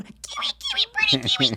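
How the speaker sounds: parakeet voice. Retold or imitated. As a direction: squeak the name like a parakeet talking to itself. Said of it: "he just was like".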